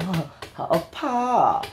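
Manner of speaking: high, drawn-out Korean intonation; mock Korean accent. Host imitating how Koreans sound, mock Korean accent. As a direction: put on a Korean lilt. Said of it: "Dito ko nakatunog Korean".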